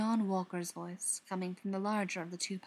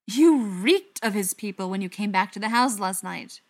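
loud, furious accusation; angry raised voice; 9 dB louder than usual. A narrator's reading. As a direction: accuse at full volume. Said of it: "the fury in his face".

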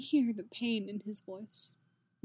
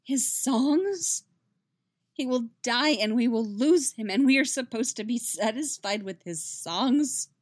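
louder, rising into a distraught cry; a narrated lover's pained, raised protest; anguished outburst; 3 semitones higher, 6 dB louder than usual. A narrator's reading. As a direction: let her grief break out loud and high. Yennefer's anguished outburst at Geralt.

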